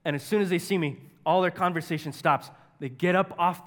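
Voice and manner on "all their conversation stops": normal talking voice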